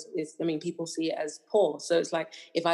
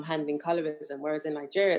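ordinary talk, nothing marked

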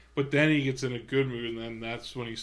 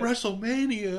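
high, excited mock cheer; mock thrilled; 10 semitones higher, 4 dB louder than usual. Newlyweds getting their wedding present, mock ecstatic. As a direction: squeal it like overjoyed fans.

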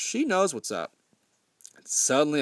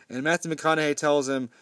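normal talking voice

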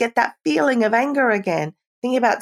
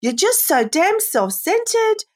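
angry parent rant; 7 semitones higher than usual. Retold or imitated. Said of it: "rather than".

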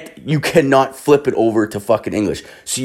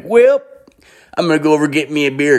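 pitched-up, hurried cheery declaration; breezy announcement; 7 semitones higher, faster than usual. Retold or imitated. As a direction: announce it in a high, carefree put-on voice. Announcing breakfast beer to girlfriend.

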